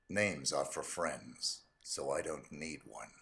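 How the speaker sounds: low, quiet, slow assassin voice; cold hitman calm, very low; low cool menace. Acted. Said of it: "say all those quotes in your voice ... Agent forty-seven".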